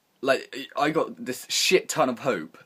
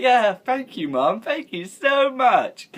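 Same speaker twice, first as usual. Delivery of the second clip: much higher, louder, slow sarcastic thank-you; 12 semitones higher, 6 dB louder, much slower than usual. Retold or imitated. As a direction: drag out fake gratitude to mock mum. Sarcastic thanks after losing Xbox.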